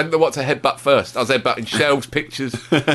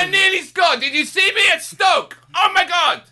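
mock vain striker; 12 semitones higher than usual. Mocking a vain striker to his co-host, hammed-up and shouting. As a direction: crow about yourself like a vain footballer. Imitating Giroud boasting.

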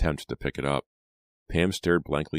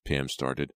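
plain reading voice

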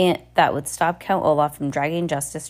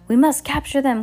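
high, raised call; 8 semitones higher, 4 dB louder than usual. A narrator's reading. Voice in the crowd urges capture, high call. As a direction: call out to rally everyone. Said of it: "called a voice in the crowd".

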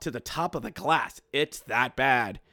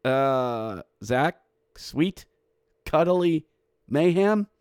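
doubtful echo, rising at the end; hesitant, unconvinced repeat; puzzled questioning; slower than usual. Real, not acted. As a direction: say it back as a baffled question. Doubts the announcer's odd goal call.

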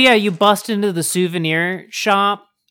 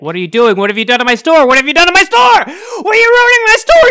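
rising, louder and higher into a crying shout; an orc shopkeeper yelling about his wrecked store; screaming shopkeeper; 10 semitones higher, 5 dB louder than usual. Acted. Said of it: "comes over screaming at you in Mandarin".

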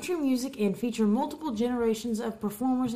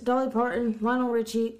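ordinary delivery

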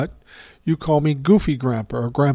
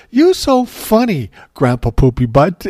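put-on little girl voice; 4 semitones higher, 5 dB louder than usual. Laughing toddler teasing grandpa.

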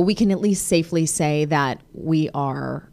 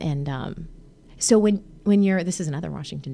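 ordinary talk

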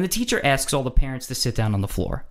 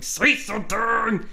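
high, drawn-out strained urging; urgent egging on; 9 semitones higher, slower than usual. Acted. To the annoyed neighbor, urging him on. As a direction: stretch it out high, willing him to act.

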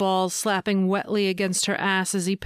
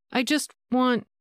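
higher, tentative, left hanging; hesitant, searching; 5 semitones higher than usual. A narrator's reading. Woman trying to express herself, hesitant. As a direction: start higher, then break off unsure. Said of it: "she paused, trying to express herself".

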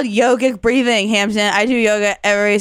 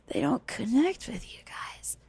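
whispered aside; 13 dB quieter than usual. Acted. Teacher drunkenly confides.

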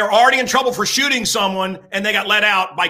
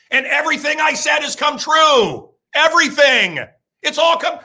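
shouting; 7 semitones higher, 3 dB louder than usual. Real, not acted. Building to the big reveal.

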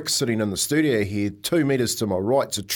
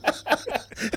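soundless laugh, softer, air only; silent laugh; 10 semitones higher, 6 dB quieter than usual. Real, not acted. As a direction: laugh without voice at the memory.

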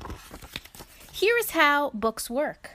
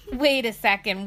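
ordinary delivery, nothing marked

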